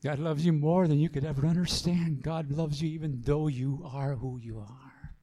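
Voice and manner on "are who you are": hushed, intimate whisper to the congregation; soft whisper of reassurance. Real, not acted. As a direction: lean in and whisper it like a secret of grace. Tender aside in sermon.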